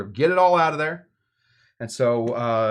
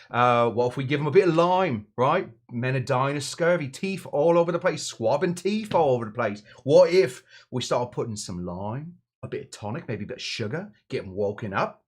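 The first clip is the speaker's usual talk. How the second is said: hammed-up English accent, eager pitch; mock-English accent that strays towards Australian. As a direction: do a rough, matey English bloke. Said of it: "probably with an English accent".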